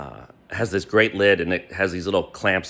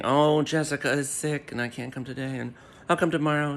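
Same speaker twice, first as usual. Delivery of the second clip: lightly put-on voice mocking her excuses; mock whiny excuse voice; mocking Kate; 4 semitones higher, 6 dB quieter than usual. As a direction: parrot her flimsy excuse, a touch sing-song. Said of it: "then kept saying".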